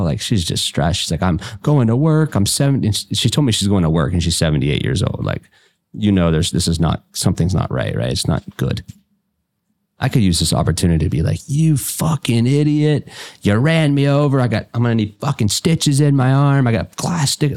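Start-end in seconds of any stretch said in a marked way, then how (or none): 1.2-2.9 put-on flustered old lady. Voicing old lady driver. 11.5-17.6 put-on hypothetical rant at the old lady who hit him; mock angry rant. Hypothetical angry outburst at driver.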